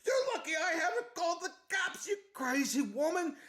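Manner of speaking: high-pitched angry shout; hammed-up exasperated yell; mock outrage. Scotsman shouting at the intruder in his house, mock outrage. Homeowner in acted quarrel.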